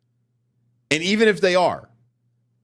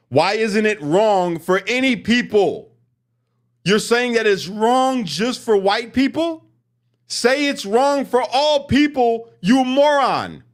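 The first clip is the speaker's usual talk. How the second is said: high and slow, hammering each word; exasperated yelling; 8 semitones higher, slower than usual. Real, not acted. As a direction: lose patience and yell it out, slowly. Angry rant at protester.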